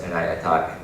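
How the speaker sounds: ordinary talk, nothing marked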